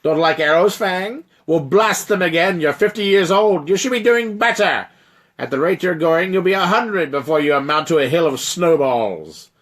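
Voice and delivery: loud, high nagging giant voice; scolding giant. Acted. Frost giant nagging the dragon to aim. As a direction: lecture the old dragon impatiently. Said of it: "cautions the giant".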